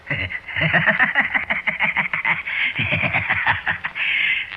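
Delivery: airy high-pitched cackle; high breathy giggle. Acted. Old drunk giggling to his pal in the dark. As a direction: let out a thin wheezing giggle. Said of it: "And he laughed".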